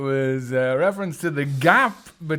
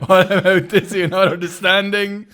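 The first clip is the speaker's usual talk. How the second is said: laughing; 5 semitones higher, 6 dB louder than usual. Real, not acted. Laughing retort.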